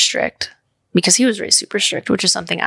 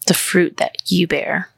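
ordinary delivery

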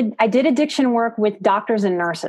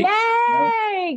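excited cheer; 13 semitones higher than usual. Real, not acted. Cheering at the invitation.

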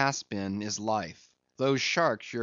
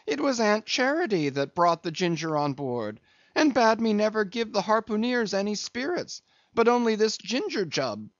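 high, defensive steward's protest; pleading excuse, climbing higher; crying out; 9 semitones higher than usual. A narrator's reading. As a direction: shift the blame in a raised pitch. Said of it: "cried Doughboy".

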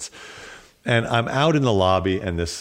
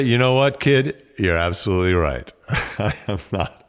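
wry imagined reply. Retold or imitated. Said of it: "I kinda wanted to say".

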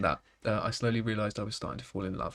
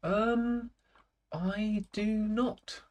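teasing slow denial; 9 semitones higher, 4 dB quieter, much slower than usual. Pretend.

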